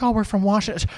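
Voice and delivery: high-pitched mock tourist; imitated tourist. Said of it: "there's, like, a tourist being like".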